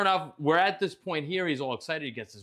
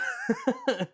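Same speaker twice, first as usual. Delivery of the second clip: high-pitched laugh. Real, not acted. Laughing at own frustration.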